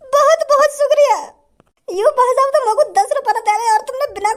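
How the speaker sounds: high, hammed-up weepy thanks; tearful gratitude. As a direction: sob your thanks to a generous benefactor. Beggar overwhelmed by huge gift. (रुआँसी, ऊँची आवाज़ में आभार)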